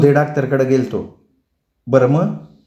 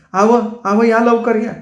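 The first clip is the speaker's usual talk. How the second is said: high urgent calling for help; wife calling husband to come quickly; 9 semitones higher, slower than usual. Acted. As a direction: call him over in panic. Calling husband after snakebite.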